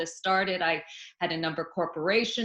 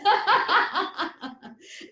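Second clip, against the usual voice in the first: high, loud laugh burst; quick volley of ha-has; deep belly laugh; 10 semitones higher, 5 dB louder than usual. Shown as a demonstration. Teacher keeps laughter contagious, high loud belly laugh. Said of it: "a deep belly laugh".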